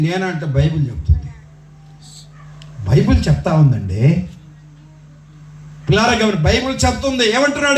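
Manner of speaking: normal talking voice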